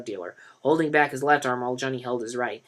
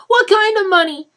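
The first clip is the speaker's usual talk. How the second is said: pressing; 12 dB louder than usual. A narrator's reading. Mother presses Denny.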